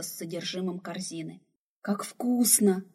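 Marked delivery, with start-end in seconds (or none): 1.8-2.8 slow, breathless whisper of delight; a slow whispered "Как вкусно", full of wonder. Acted. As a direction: savour each word in a hushed whisper. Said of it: "восхитилась Баст".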